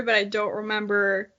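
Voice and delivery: ordinary talk, nothing marked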